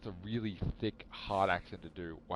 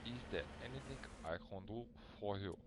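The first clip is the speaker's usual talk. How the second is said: put-on French accent; 7 dB quieter than usual. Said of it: "speaking with a French accent".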